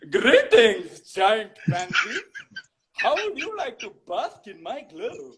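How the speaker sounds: hammed-up royal party host with put-on accent; King Julian. Voice actor greeting as the Madagascar cartoon king, showy comic impression. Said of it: "we all heard King Julian from Madagascar saying".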